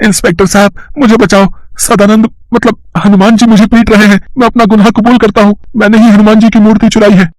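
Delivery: panicked confession. Acted. Thief fleeing to the inspector, terrified high-pitched confession. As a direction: blurt it all out in terror.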